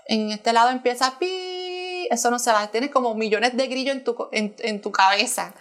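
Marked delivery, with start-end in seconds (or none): 1.2-2.1 long, high-pitched "piii"; imitated ringing. Showing listener the ringing in one ear, imitated high whine. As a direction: hold a steady high ringing tone. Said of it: "es un zumbido que está".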